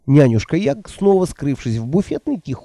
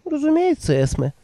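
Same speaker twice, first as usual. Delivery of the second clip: put-on higher, timid old woman voice; meek old woman; 14 semitones higher than usual. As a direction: answer meekly, eager to please. Nanny voiced by narrator.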